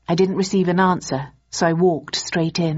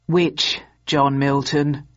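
weary; 3 semitones lower, much slower than usual. A narrator's reading. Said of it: "desk sergeant wearily".

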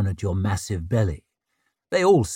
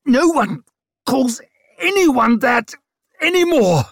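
very high, loud, broken by gasps; furious breathless snarl; 13 semitones higher, 5 dB louder than usual. A narrator's reading. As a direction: shout it in rage between breaths. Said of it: "she snarled over her shoulder between whooping breaths".

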